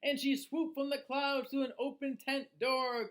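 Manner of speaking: put-on lively, sing-song read-aloud for children; storybook reading. A narrator's reading. Reading a rhyming book to children, lively storyteller voice. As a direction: read it like bedtime story time.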